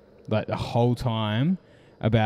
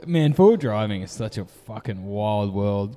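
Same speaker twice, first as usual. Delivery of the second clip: ordinary talk, nothing marked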